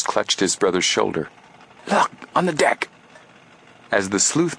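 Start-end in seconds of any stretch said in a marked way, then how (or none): 1.9-2.9 high, sudden startled call; startled cry. Acted. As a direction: catch sight of something and blurt it out. Brother clutches shoulder, sees figure.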